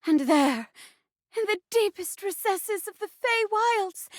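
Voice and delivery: grand, lilting forest spirit; Spindle. Acted. Forest spirit to a traveler on a quest, declaiming. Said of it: "I'm playing Spindle, a forest spirit".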